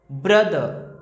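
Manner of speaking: Brother with the first syllable ब्र punched and the end दर light; stress on BR. Shown as a demonstration. Teacher showing the class the stress in brother, first syllable hit. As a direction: lean hard on ब्र in brother, let दर fall away. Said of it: "इस पे ज़्यादा focus है".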